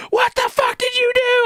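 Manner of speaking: a very high, outraged mom yell; shocked shout. Retold or imitated. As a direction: explode at your son in disbelief.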